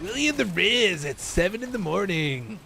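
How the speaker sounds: slow, swooping morning-show host; radio DJ bit. Acted. Streamer reviving an old morning radio bit, hammy DJ voice. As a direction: stretch it out high like a hammy DJ. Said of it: "I used to do this bit".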